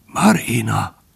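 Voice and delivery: weak call with some voice returning; straining. A narrator's reading. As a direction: manage to voice the name, with effort. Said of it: "mondta erőlködve". (erőlködve, gyengén szólítva)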